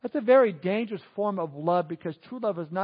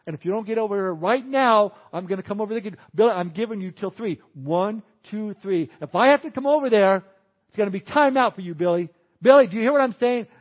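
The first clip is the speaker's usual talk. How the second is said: put-on loud, insistent hollering at a child; yelling parent; 7 dB louder than usual. Parent yelling across the room at Billy. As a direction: nag him loudly to come over now. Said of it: "we say, you know".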